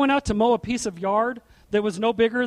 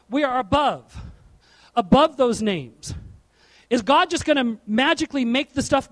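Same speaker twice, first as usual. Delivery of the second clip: preaching; 4 dB louder than usual. Acted. Preaching on being above.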